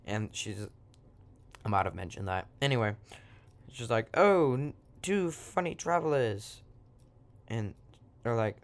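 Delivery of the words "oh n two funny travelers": high, drawn-out British witch voice; lilting British accent, pitched up. Acted. Witch greets two travelers, in a British accent. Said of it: "And I'm being British again".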